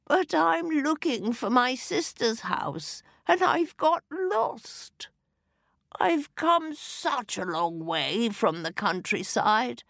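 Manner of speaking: put-on high, quavering old lady; wobbly frail old woman voice. A narrator's reading. Fairy disguised as old lady, quavering and high.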